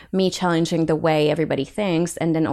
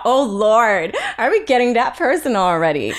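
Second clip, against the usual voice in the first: amused protest, laughing through the words; higher, laughing, mock-dismayed; 6 semitones higher, 4 dB louder than usual. Real, not acted. Teased with a personal question.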